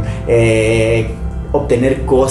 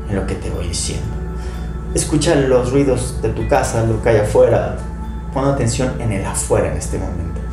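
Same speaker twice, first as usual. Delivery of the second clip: calm guided meditation voice; right in your ear; 4 dB quieter than usual. Acted. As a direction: guide them gently into stillness.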